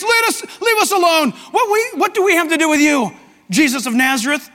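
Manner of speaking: high, shouted protest; freaking out. Acted. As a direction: cry out like a panicked demon. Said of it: "This guy freaks".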